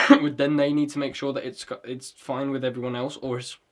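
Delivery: coughing, throat catching; choking on a drink. Real, not acted. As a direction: talk through a choke. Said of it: "sorry, I'm choking".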